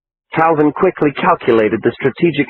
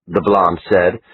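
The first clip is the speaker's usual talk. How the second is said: plain reading voice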